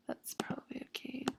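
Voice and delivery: barely voiced, under her breath; whispered aside. Real, not acted. Teacher checking the line spacing, whispered to herself. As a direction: drop to a breathy private murmur.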